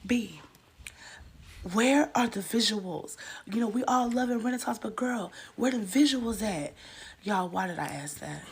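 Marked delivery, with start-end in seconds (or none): 0.1-6.7 pushy fan demand. Acted. Pushy fan demanding new visuals.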